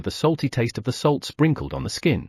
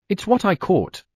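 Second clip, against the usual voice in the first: higher, eager little bear voice; eager baby bear; 8 semitones higher than usual. Acted. Baby bear asking to eat the wolves, higher and eager. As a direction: beg like a hungry cub.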